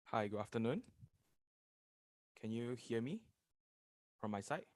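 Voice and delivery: faint voice, far from the mic; barely audible. Real, not acted. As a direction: speak too softly for the mic. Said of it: "Your voice is very low".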